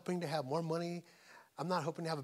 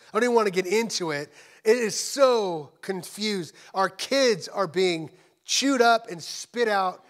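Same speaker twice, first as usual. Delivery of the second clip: loud, raised, impassioned sermon voice; big preaching delivery with stretched emphatic words; full-force preaching; 6 semitones higher, 10 dB louder than usual. Real, not acted. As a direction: drive it out to the congregation, hammering key words.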